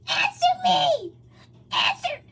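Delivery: high, loud, slow shout; shouted demand. A narrator's reading. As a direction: bellow it, demanding an answer. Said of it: "he continues".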